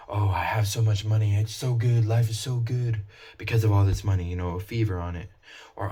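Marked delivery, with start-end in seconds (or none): 0.1-4.2 mock gloating, higher and louder; a hammed-up rich person in love with their wealth. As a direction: gush about your money like you're giddy with it. Voicing someone rich gloating.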